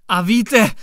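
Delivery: loud, agitated blurt; blurting excitedly. A narrator's reading. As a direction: burst out, all worked up. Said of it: "vyhrkl rozčileně".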